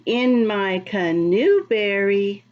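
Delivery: ordinary delivery, nothing marked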